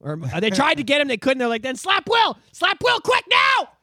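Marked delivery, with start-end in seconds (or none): 1.6-3.7 high, slow yelled order; mock urgent shout. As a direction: yell it like a frantic mob. Mock crowd urging a slap.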